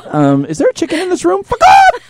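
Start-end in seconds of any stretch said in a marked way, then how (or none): 1.4-2.0 very high, loud shriek; startled yelp. Real, not acted. Host startled by a chicken in the room, shriek. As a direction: jump out of your skin.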